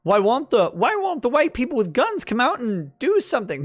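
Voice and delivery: high, whining mock protest; mocking imitation. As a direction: mimic indignant complainers, pitched well up. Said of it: "that they're like".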